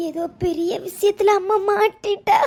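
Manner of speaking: usual speaking voice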